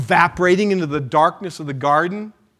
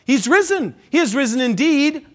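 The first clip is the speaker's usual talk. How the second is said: raised, ringing call of he is risen; Easter acclamation; 5 semitones higher, 3 dB louder, faster than usual. Real, not acted. Pastor leads congregation in the Easter call, raised and joyful. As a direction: proclaim it with joy.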